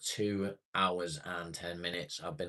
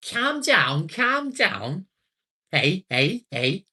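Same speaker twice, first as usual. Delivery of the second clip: high, loud, exaggerated Scouse impression; hammed-up Liverpool accent, pitched way up and shouted; mock Scouse; 13 semitones higher, 12 dB louder, much slower than usual. Streamer mocking the accent he can't abide, exaggerated Scouse. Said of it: "I can't abide Scouse accent".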